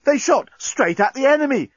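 very high-pitched eager voice; excited corporal. A narrator's reading. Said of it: "exclaimed Inchby Wiglet enthusiastically".

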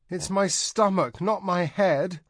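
high, slow whimper. A narrator's reading. Seasick princess whimpering back at Tanar, high and slow. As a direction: whine it out like a miserable child. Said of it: "Sazerac whimpered".

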